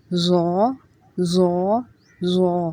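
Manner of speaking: زو said heavy and rounded with no hiss; a thick, unwhistled زو; no whistle. Shown as a demonstration. Teacher showing زو without the whistle of زا. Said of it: "اس کو کیسے پڑھنا ہے".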